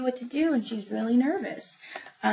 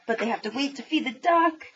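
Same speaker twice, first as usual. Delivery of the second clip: ordinary talk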